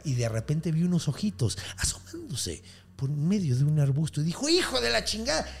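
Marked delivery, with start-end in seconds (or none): 4.5-5.5 retold cry of alarm on spotting someone; startled curse. Bather spotting eyes in the bush, startled curse.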